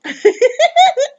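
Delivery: sudden high laugh; high burst of laughter. Real, not acted. Narrator laughing about the coach's t-shirt. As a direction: laugh at your own joke. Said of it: "It's true".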